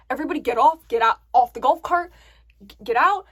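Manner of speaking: loud retold command; urgent order. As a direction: order everyone out in an emergency. Retold friend ordering everyone off.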